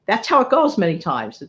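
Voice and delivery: normal talking voice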